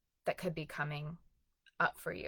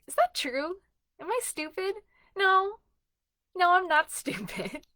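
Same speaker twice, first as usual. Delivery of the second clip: much higher, louder no to the critic; defiant self-talk; 12 semitones higher, 4 dB louder than usual. Shown as a demonstration. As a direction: push back, playful and firm. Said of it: "just ask yourself".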